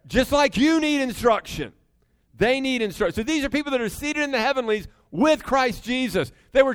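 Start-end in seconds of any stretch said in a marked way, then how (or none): none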